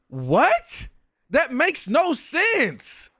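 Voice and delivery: high, drawn-out incredulous protest; exasperated and incredulous; baffled disbelief. Real, not acted. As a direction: stretch it out, pitch leaping up in disbelief. Baffled reaction to clip.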